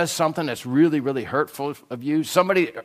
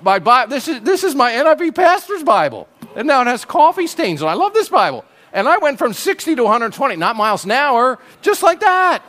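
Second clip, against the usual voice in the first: higher, louder, rushing with real exasperation; worked-up outburst; 8 semitones higher, 6 dB louder than usual. Real, not acted. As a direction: let the frustration boil over, pitch climbing. Coffee spilled on his Bible.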